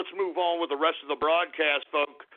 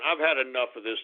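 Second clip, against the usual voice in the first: usual speaking voice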